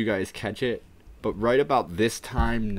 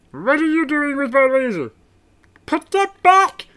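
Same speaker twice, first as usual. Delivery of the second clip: outraged high cartoon alien; very loud, squeezed villain scolding; Marvin the Martian; 11 dB louder, slower than usual. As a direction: shout high at a thieving rabbit. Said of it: "Marvin the Martian".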